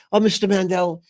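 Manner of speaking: put-on high cutesy voice; cute woman's voice. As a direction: coo it high, imitating a cute old hand. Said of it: "in the cutest of ways".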